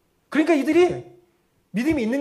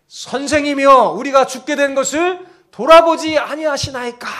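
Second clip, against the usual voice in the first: loud, high-pitched desperate complaint; angry protest; 8 semitones higher, 11 dB louder than usual. Acted. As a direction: cry out accusingly to the sleeping master. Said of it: "죽기 살기로 덤벼드는 화나는 목소리로". (화난 목소리로 크게 따지듯 외치기)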